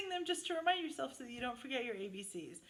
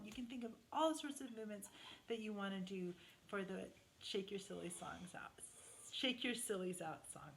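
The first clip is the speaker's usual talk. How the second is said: whisper; 5 semitones lower, 8 dB quieter than usual. Real, not acted. Aside to children.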